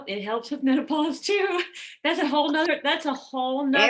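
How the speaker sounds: high, loud and laughing; amused exclamation. Real, not acted. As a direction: exclaim it loudly through a laugh. Amused reply about menopause topic.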